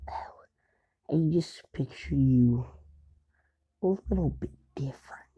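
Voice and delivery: slow whispery villain musing; eerie half-whispered villain voice; creepy villain. Retold or imitated. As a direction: whisper it slowly, unimpressed and sinister. Villain Shigaraki sizes up Deku.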